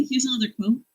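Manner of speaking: ordinary talk, nothing marked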